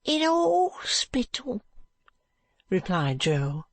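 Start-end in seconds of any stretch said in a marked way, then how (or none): 0.0-1.7 put-on high, tearful boy's voice, resigned; a dying boy telling the doctor he is moving on; ragged street boy. Joe answers Alan.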